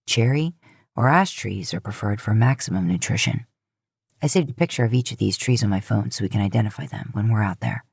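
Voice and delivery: a man listing facts from his phone; Dean reading. Acted.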